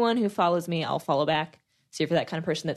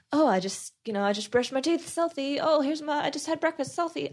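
rising, higher valley-girl voice mocking selfie takers; mock selfie person; 8 semitones higher than usual. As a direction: lightly imitate someone who posts every selfie. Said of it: "never just been the type to just like".

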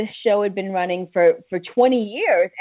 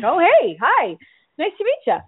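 surprised greeting; 7 semitones higher than usual. Retold or imitated. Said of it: "and I was like".

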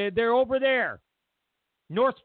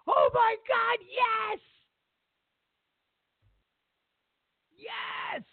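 cheering crowd; much slower than usual. Retold or imitated. Rally crowd cheering for Trump, high excited yells. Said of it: "Everybody went".

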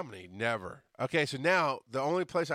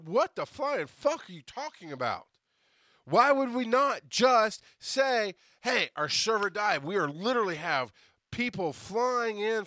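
louder, higher heated protest; angry rant at boss; 5 semitones higher, 5 dB louder than usual. Retold or imitated. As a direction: push back hard, voice raised. Telling off his boss.

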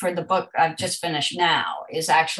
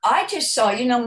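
ordinary talk

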